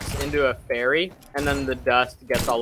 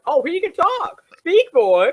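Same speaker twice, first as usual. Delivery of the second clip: high, loud command to an animal; insistent witch; 9 dB louder than usual. Retold or imitated. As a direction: urge him loudly, pitched way up. Acted witch showing off Donkey.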